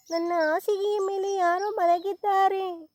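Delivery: put-on high, squeaky baby bear complaint; a cub trying to sound fierce; baby bear's tiny high grumble; little bear growling. A narrator's reading. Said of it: "ಎಂದು ಗುರುಗುಟ್ಟಿತು".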